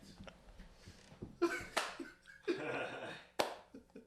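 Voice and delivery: high, wheezy laughing fit; helpless laughter. Real, not acted. Podcaster cracking up at his friend, wheezing laughter. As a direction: laugh till there's no voice left.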